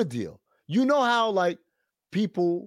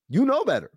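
high, pointed you know better; scolding; 7 semitones higher than usual. Retold or imitated. Host scolding Angela Rye over her post, pointed and high. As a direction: wag a finger at her with it.

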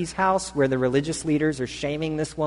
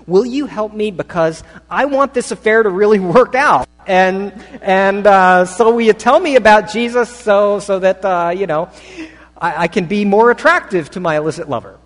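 louder, higher, incredulous mock plea; preacher lampooning the woman's strange ask, louder and higher; mock pleading; 5 semitones higher, 9 dB louder than usual. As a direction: ham up her absurd request. Preacher mockingly restates her request.

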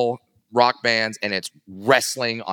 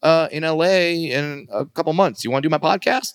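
1 lightly voiced [name]; 10 semitones higher than usual. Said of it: "he DMs me and says".

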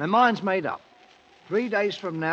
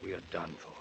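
much softer, lower defeated words; trapped king softly admitting defeat; quiet resignation; 13 semitones lower, 17 dB quieter than usual. Acted. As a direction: let the hope drain out. Trapped by a thousand warriors.

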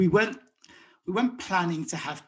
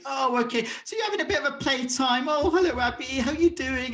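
put-on higher, sing-song cooing to a baby; baby talk; 7 semitones higher than usual. Father greeting his baby son mid nappy change, higher cooing baby talk. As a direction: coo at the little one on the changing mat.

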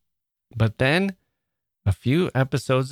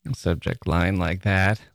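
ordinary delivery

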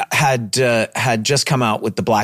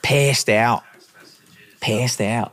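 put-on Philly accent; much slower than usual. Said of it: "he's got that accent".

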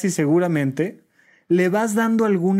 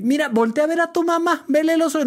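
put-on much higher sing-song coaxing a crying child; coaxing mother; 11 semitones higher, faster than usual. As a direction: pitch way up to win a baby's attention.

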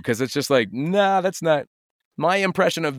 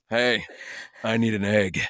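lower, gruff game-hero voice; Chris Redfield voice; 6 semitones lower, 4 dB quieter than usual. Retold or imitated. Imagining hitting on a clerk in a lower hero voice. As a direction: put on the hero voice to hit on her. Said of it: "I walk up to her and I go".

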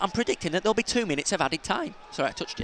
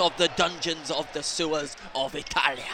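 mock Italian curse; 2 semitones lower, 6 dB quieter than usual. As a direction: rant like a cartoon Italian. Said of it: "When you say it in Italian".

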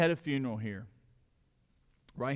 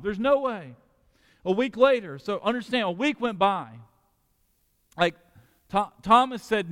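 rising, louder sermon voice building to emphatic peaks; full-force preaching; 8 semitones higher, 5 dB louder than usual. Real, not acted. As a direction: preach it out to the whole church with conviction. Pastor preaching to the congregation.

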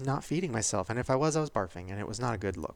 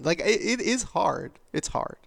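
ordinary talk, nothing marked

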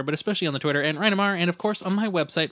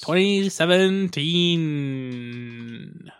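put-on old radio show; much slower than usual. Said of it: "you gotta do it in an old radio voice".

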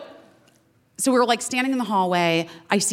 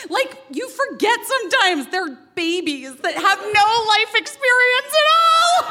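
laughing; 13 semitones higher, slower than usual. Real, not acted. Laughing about kids being babies.

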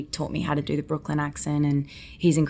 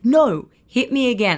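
higher, louder mock insistence, lightly voiced; eager insistence; 8 semitones higher, 6 dB louder than usual. Leo urging her to slap him again, eager mock insistence. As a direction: push it like an eager actor. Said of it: "He's like".

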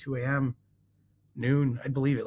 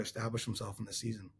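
barely audible; 3 semitones lower, 11 dB quieter than usual. Real, not acted. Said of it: "Your voice is very low".

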